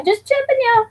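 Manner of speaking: high playful ribbing; high teasing. Pretend. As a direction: lilt it up to tease. Teasing repeat, big pitch jump.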